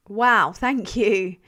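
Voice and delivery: normal talking voice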